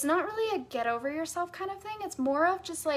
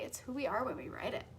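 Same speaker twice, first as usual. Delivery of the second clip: ordinary talk, nothing marked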